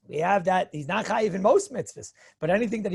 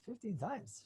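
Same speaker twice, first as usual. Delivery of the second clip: hushed, voiceless whisper; whispered aside; 15 dB quieter, slower than usual. Acted. Speaker reacting to fifteen listens of his shiur, whispered aside. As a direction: whisper it to yourself in wonder.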